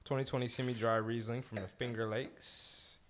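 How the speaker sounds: ordinary talk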